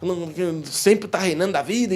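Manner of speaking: retelling a waitress's outburst, mock furious; mock furious rant. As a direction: play the stressed waitress snapping, a little hammed up.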